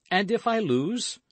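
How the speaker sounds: high, rising anxious query; nervous challenge, voice lifted; worried question. A narrator's reading. D'Artagnan hesitates before the wager.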